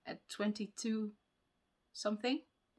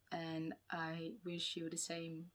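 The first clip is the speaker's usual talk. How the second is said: slower, quieter, deliberate words; slowed down; 2 semitones lower, 8 dB quieter, slower than usual. Real, not acted. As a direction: take it slow and careful. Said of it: "Let's slow down".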